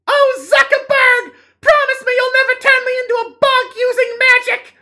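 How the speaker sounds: very high, loud swooning gasp; swooning woman voice. Acted. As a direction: gush at him like a starstruck girlfriend. Saltine speaks in the play.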